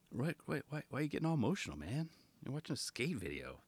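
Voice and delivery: soft, hushed imitation of a baffled housemate; puzzled onlooker. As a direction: question it quietly, under your breath. Voices housemate catching him crying.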